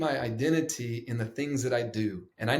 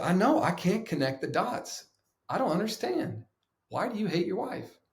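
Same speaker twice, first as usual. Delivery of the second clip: lightly voiced mentor, pitched up in mock confusion; puzzled mentor; 8 semitones higher than usual. Mentor quizzing the speaker about his wife, mock puzzled and higher. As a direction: play the baffled mentor, higher.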